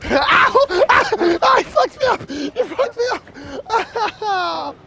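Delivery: very high squealing yelps; hammed-up pain. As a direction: wail as if badly hurt in a play fight. Crying out in play fight.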